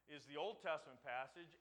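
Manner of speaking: ordinary talk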